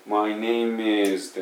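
"My name is" slowly, name and is fused into "neimiz"; slow, link "name is" into one syllable "miz". Shown as a demonstration. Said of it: "Mizになるわけね".

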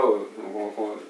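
ordinary delivery

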